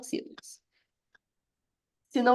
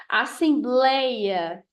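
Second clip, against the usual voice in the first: "Assembleia" very slowly, BLEI stretched out; stress on BLEI; much slower than usual. Shown as a demonstration. Teacher showing the open diphthong, BLEI drawn out in Assembleia. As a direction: draw out Assembleia, leaning on the BLEI. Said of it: "Se aparecer o blei".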